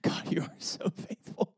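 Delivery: hushed, breathless praise; awed whisper. Retold or imitated. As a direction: whisper it in awe to God. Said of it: "I'm just like".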